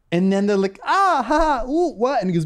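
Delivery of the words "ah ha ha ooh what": imitating girls, high startled giggly oohs; high mock confusion, imitated; startled reaction. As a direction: put on a high, thrown-off little "what?".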